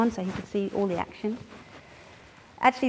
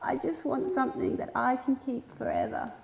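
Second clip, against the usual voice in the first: put-on mild sobbing child; 3 semitones higher, 5 dB quieter than usual. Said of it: "he started to sob".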